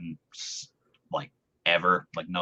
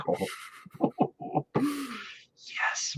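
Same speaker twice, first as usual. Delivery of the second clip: breathless, very high squeal with a laugh; airy, laughing fan shriek; girlish squeal; 6 dB quieter than usual. Retold or imitated. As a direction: squeal like an overjoyed fangirl. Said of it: "I swear I screamed like a girl".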